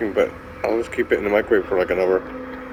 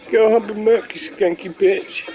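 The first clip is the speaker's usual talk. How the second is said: put-on failed London accent; 10 semitones higher than usual. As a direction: try a London accent and miss it. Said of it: "I can't seem to do that accent".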